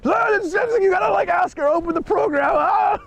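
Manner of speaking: high-pitched hammed-up hysterical lady; frantic drunk lady. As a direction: squeal it like a drunk lady losing it. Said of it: "she's going mental".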